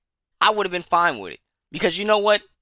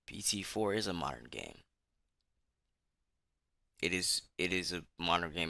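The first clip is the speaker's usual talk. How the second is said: much softer and lower; deflated admission; 10 semitones lower, 15 dB quieter than usual. Real, not acted. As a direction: let it sink in, resigned. Bitter realization, dropping voice.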